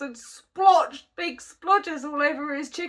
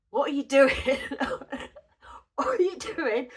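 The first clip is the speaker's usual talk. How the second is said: laughing retold question.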